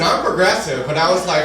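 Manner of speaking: normal talking voice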